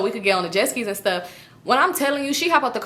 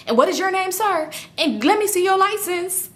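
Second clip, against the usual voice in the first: high prissy demand; 9 semitones higher than usual. Retold or imitated. Friend mock-demands the driver's license.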